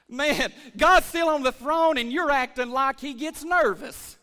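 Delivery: normal talking voice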